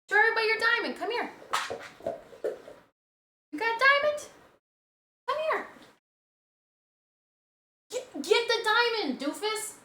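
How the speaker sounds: very high, squeaky coaxing; high-pitched, excited coaxing; playful dog talk. Real, not acted. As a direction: call the dog over in a high pet voice. Coaxing dog to show toy.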